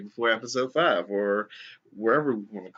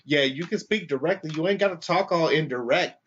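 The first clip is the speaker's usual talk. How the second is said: higher, mocking challenge; imitated snide provocation; taunting; 6 semitones higher than usual. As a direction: goad her with a high sneer. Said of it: "the lady with the dog is just like".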